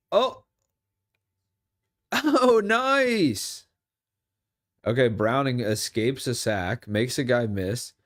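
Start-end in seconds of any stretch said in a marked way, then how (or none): 0.1-3.7 high, loud laughing oh nice; delighted. Real, not acted. Streamer watching Browning escape, high delighted cheer. Excited reaction to a play.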